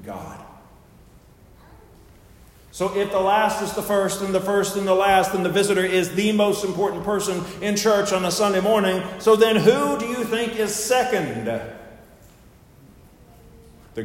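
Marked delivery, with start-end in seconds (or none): 2.7-11.5 raised, emphatic pulpit summing-up; preaching. Real, not acted. Preacher asks the congregation who ranks second, emphatic preaching. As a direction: build to the next question for the pews.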